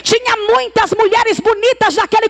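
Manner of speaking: exhortation climbing in pitch toward a shout; rally the women, driving harder as you go; impassioned preaching. Real, not acted. Preaches at full force. (pregação empolgada, crescendo até o grito)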